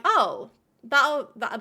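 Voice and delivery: ordinary talk, nothing marked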